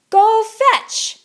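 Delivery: high, loud barked order; barked command. Acted. Poodle principal throwing balls, barking a high loud command. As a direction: bark it at the whole class. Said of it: "barked Missus Begalot".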